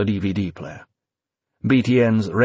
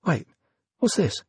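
surprised; 7 semitones higher than usual. Acted. Evie startled by news.

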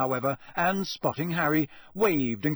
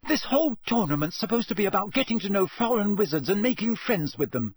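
fast, high, heated argument; hot retort; 7 semitones higher, faster than usual. A narrator's reading. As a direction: argue hotly in a rush. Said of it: "said Hermione hotly".

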